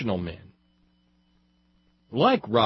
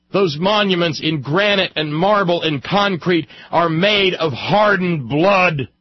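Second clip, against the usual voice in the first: loud, high speechifying; impassioned oratory; 9 semitones higher, 9 dB louder than usual. Real, not acted. Speaker orating on Civil War monuments and blood, impassioned. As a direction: drive every phrase home, loud and high.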